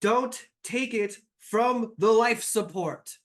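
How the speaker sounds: high, loud panicked shout; desperate shout about the life support; panicked yell. Acted. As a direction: yell it in alarm, pitched high. NPC panics over life support.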